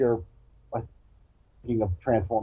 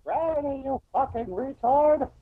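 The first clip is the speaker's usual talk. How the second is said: mock outraged shout; 14 semitones higher than usual. Real, not acted. Mocking the truther caller.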